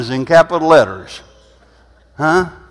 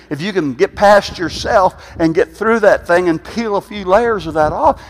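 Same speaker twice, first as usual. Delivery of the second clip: normal talking voice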